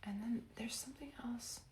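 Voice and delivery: soft secretive whisper. Real, not acted. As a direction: lean in and whisper. Hushed aside mid-reading.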